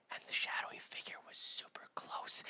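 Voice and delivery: breathy suspense whisper. A narrator's reading. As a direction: whisper it with dread.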